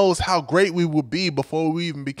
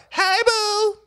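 very high, drawn-out sing-song call; Monique's sing-song; 3 dB louder than usual. Retold or imitated. Monique greets the professor she has a crush on, high sing-song. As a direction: call it out high and held, like a lovestruck pest. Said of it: "that's the way she said it".

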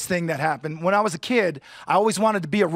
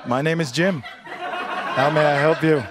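heaven call-center agent; 4 semitones lower, slower than usual. Acted. Standup voicing heaven's Indian agent.

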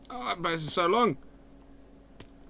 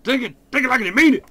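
loud fast taunt, high; taunting shout; 8 semitones higher, 9 dB louder, faster than usual. Real, not acted.

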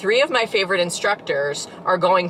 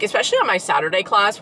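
ordinary talk

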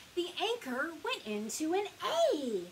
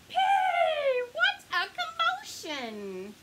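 squawking parrot; 6 dB louder than usual. A narrator's reading. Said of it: "A parrot squawked".

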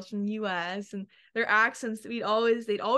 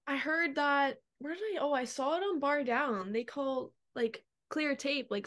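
normal talking voice

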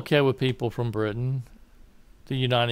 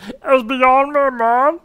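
high, loud, drawn-out exasperation; mock bafflement; 10 semitones higher, 6 dB louder, much slower than usual. Scoffing at parents paying for elite colleges, exaggerated bafflement. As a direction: stretch it out in a put-on high voice.

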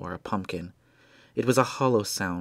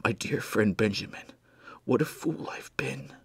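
dying whisper. Acted. Dying Blair to his friend, a weak whisper. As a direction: speak as if almost out of breath.

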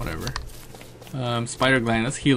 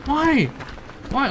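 indignant squeal; 13 semitones higher than usual. Real, not acted. Mobs keep attacking him.

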